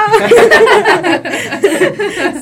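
high burst of loud laughter. Real, not acted. Friends joking about the vendor, laughing out loud. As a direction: break up laughing at the joke.

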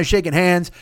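usual speaking voice

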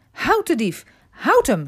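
high, loud cry of Stop thief; woman shouting at the thief, loud and high; shouting after a thief. A narrator's reading. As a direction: yell after the running dog. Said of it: "riep ze".

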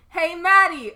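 loud call across the room. A narrator's reading. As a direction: call her name from a distance. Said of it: "a voice called out".

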